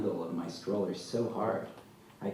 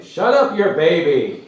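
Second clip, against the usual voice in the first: full-force high yell; loud, outraged holler at the noise; angry neighbour's shout; 10 semitones higher, 15 dB louder, slower than usual. A narrator's reading. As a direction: bellow it from a window at night. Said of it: "someone finally shouts from a window".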